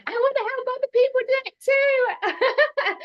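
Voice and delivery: high, loud and laughing; excited resolve. Retold or imitated. Guest recalls her decision to help others, excited and laughing. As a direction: burst out with eager new purpose. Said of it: "I was like".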